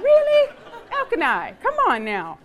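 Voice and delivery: high, loud disbelief, then scolding; incredulous "oh please" rebuke; mock exasperation. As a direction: call him out in playful exasperation. Mock rebuke of tactless husband.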